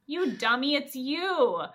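playfully exasperated jab; exasperated retort. Retold or imitated. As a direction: set a clueless friend straight, loudly. Gladys scolds clueless Steve.

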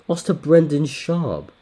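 high, loud and drawn out in shock; can't believe what he's reading; stunned disbelief. Real, not acted. Reading a fighter's record aloud, shocked and loud.

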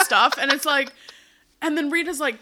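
usual speaking voice

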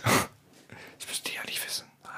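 hushed aside to listeners; whispering. Real, not acted. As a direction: whisper it like a secret.

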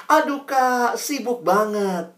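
high, slow whining student; student excusing himself, whining high; whiny excuse. Retold or imitated. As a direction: complain like a busy teen.

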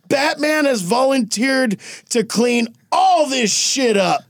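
high, slow mock proclamation; publicly volunteer someone with relish; Superman announcing. Superman bossing Batman in public.

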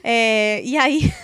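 giggling helplessly; can't stop laughing; laughing fit. Real, not acted. Laughing fit after hiccup.

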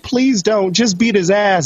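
acted urgent appeal; mock pleading. Voices Jess begging the pimp.

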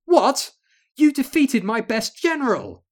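high, loud shocked cry; outraged disbelief at defeat. Acted. General thrown aside.

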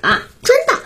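high-pitched astonished question; surprised. A narrator's reading. Child hearing of dad's bankruptcy, surprised. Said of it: "我惊讶地问".

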